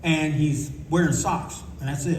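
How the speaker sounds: normal talking voice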